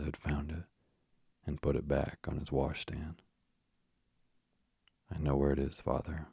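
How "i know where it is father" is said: ordinary delivery, nothing marked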